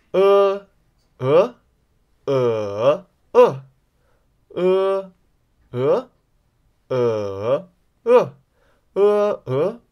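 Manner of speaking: cycle 呃 through the four Mandarin tones; four-tone drill. Shown as a demonstration. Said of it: "Are you ready for an exercise".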